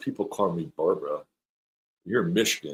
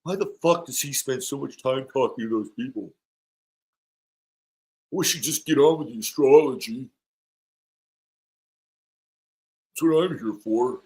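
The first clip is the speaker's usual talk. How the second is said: mock grumbling viewer, pitched higher; a hint of a put-on complainer's voice; imitating complaining viewers; 6 semitones higher than usual. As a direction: lightly act out an impatient audience member. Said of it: "will say".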